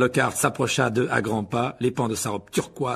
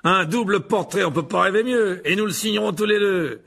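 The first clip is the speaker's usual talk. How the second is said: grand, delighted proclamation; Lockhart, jovial showman; 8 semitones higher, 6 dB louder than usual. Acted. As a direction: sing out each phrase with self-satisfied flourish. (Lockhart ravi et théâtral)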